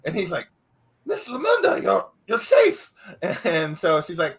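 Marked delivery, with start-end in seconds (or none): 1.0-2.9 much higher, relieved guard voice; a guard amazed to see her; guard greeting the rescued woman, surprised. Acted.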